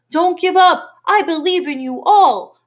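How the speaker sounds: loud, high plea of encouragement; calling out. Acted. Horton calling to the Mayor while being caged, loud. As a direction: shout hope down to the speck. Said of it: "he managed to call to the mayor".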